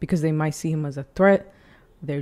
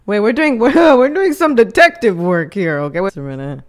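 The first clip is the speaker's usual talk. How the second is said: loud, higher-pitched, with a laugh; excited realization; 3 semitones higher, 9 dB louder than usual. Real, not acted. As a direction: burst out, thrilled to be on the case. Excitedly starts working out the mystery.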